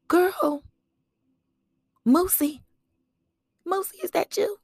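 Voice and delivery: high, loud surprised call of a nickname; excited greeting. Retold or imitated. Greeting her old friend at the game, high and excited. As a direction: call out in happy disbelief. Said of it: "I remember seeing her like".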